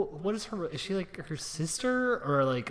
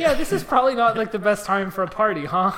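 loud, higher, over-chummy party-guest voice; mock awkward guest; 6 semitones higher, 10 dB louder than usual. Guest leaving the party, loud mock awkwardness. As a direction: play up the awkward small talk.

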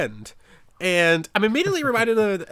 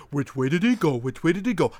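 dumb cartoon dog. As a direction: sound like the stupid dog from Looney Tunes. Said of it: "like that dumb dog in".

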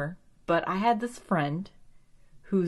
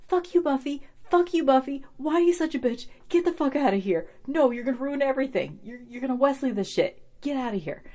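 higher, louder, mock furious yelling at Buffy; mock angry rant; 7 semitones higher, 4 dB louder, faster than usual. Viewer yelling at Buffy on screen in hammed-up anger. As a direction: rant at the character like she can hear you. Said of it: "I'm like".

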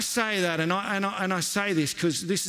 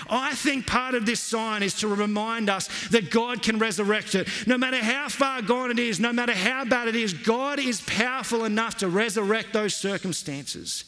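impassioned preaching; 8 semitones higher than usual. Acted. Preaching over music.

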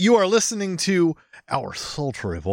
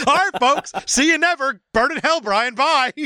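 higher, mock-indignant farewell; mock sign-off; 7 semitones higher than usual. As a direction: storm off the show in jest. Mock ending the show in outrage.

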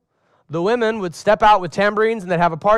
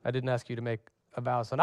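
quick, much softer aside; exasperated dad; 6 semitones lower, 12 dB quieter, faster than usual. Pretend. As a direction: brush off the kid's vow, fast and low. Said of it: "one of my sons says to me".

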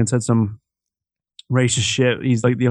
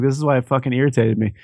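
usual speaking voice

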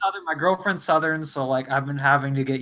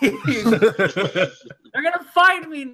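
high, slow, laughing fake dread; mock fear; 9 semitones higher, much slower than usual. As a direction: laugh through pretend paranoia. Joking panic over full name.

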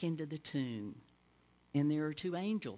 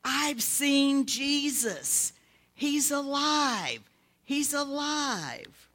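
high, loud, slow shout; shouted news that he lives; joyful shout; 11 semitones higher, 8 dB louder, much slower than usual. Acted. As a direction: cry it out with joy. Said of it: "and she shouted".

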